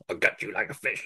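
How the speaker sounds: soft mock-menacing phone killer; menacing turn. Podcaster mimicking the film's phone killer, quietly menacing. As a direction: drop the volume for a lightly imitated threat. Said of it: "really warm voice until it goes fucking".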